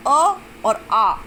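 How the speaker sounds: short अ, then आ held twice as long; short versus long vowel. Shown as a demonstration. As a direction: slowly contrast a brief अ with a drawn-out आ. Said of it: "अ में थोड़ा समय लग रहा है और आ में double समय".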